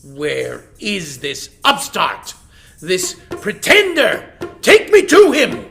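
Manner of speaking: king demands to face the rival; furious lion. Acted.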